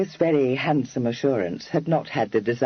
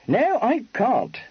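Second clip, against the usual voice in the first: slow, higher, blunt admission; 11 semitones higher than usual. A narrator's reading. Freddy bluntly conceding to Kitty, slow. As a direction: state it flatly and slowly. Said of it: "said Freddy bluntly".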